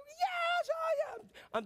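yes, I am squealed very high; ecstatic fan yell. Retold or imitated. Preacher acting out his playoff cheering, squealing very high. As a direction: shriek it like a fan at a playoff win. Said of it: "I'm like".

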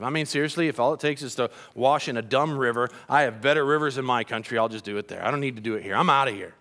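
low, muted imitated grumble; mock offended. Naaman, offended, decides to leave, low mock-disgusted grumble. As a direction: grumble it low like a man walking out. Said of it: "he was offended".

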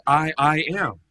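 emphatic quote of Jesus. Retold or imitated. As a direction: declare I, I am. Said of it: "he made an emphatic statement".

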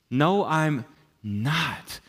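very slow, drawn-out denial; stubborn refusal. Retold or imitated. Pastor refusing what Jesus says, stubborn refusal. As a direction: stretch each word out in protest.

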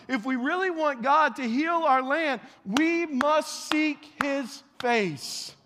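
loud, rising preacher's cry; fervent revival preaching; preaching at full force. Acted. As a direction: build the congregation up with passion.

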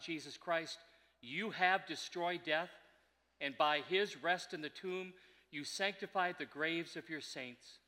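solemn liturgical committal reading; hushed graveside prayer. Acted.

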